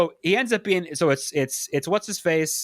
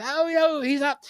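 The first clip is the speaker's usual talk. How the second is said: a hint of a fretful mother, higher; lightly voiced mom; 10 semitones higher than usual. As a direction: mimic mum fussing on the phone, lightly. Voicing the mom on the phone.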